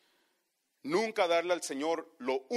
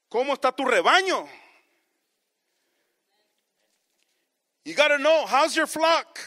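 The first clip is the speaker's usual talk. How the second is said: higher and much louder, driving the point home; preaching shout; 7 semitones higher, 8 dB louder than usual. Real, not acted. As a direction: call it out to the whole church. Preacher builds to a climax.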